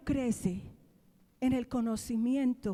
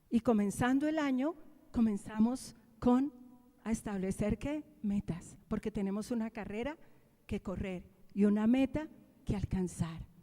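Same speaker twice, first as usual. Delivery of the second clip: usual speaking voice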